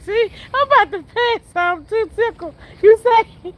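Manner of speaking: normal talking voice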